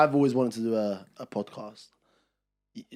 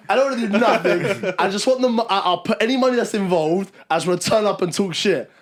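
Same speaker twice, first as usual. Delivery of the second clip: laughing through the quote, higher and louder; 7 semitones higher, 8 dB louder than usual. Retold or imitated. Retelling Mo's offer to podcast, laughing through it. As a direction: giggle through a friend's lazy offer.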